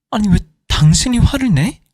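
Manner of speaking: furious wife, voice shooting up; indignant outburst. Acted. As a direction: fire it back at him in disbelief. Wife explodes at husband.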